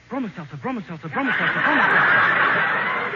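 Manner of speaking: train whistle imitation. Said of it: "The one that goes".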